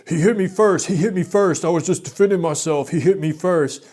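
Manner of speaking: high, loud whiny protest; mock the other guy pleading his innocence; mimicking frantic guy. Imitating the man who punched him, high and loud. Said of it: "he's talking real fast".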